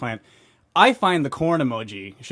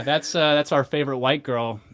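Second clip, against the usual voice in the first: normal talking voice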